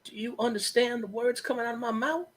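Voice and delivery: mock movie quote. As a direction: ham up the famous line in a lightly voiced character. Quoting movie line back jokingly.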